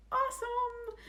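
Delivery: squeaky high delighted whisper-thought; a sky-high thrilled little cheer; gleeful inner voice. Acted.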